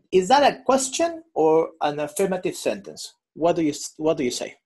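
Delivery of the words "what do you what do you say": usual speaking voice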